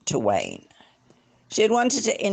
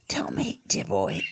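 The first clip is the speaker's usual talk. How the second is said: softer, lower hushed whisper; hoarse whisper; 9 semitones lower, 6 dB quieter, slower than usual. Acted. Host confiding to the guest in a whisper. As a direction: lean in and whisper it. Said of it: "he said in a hoarse whisper".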